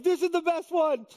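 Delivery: high gushing delight; a sudden high-pitched gush over the song; excited. Retold or imitated. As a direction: gush like a teen fan. His excited pick of the song.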